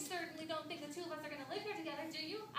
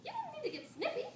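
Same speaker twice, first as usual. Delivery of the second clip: high-pitched, offended reproach; indignant chiding; 12 semitones higher than usual. Acted. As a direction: chide your husband for his tone. Wife chides husband's snippiness.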